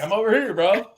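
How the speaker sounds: Reed answering back. Acted. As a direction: call back as if hailed by mistake. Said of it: "Reed's like".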